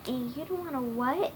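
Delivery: plain reading voice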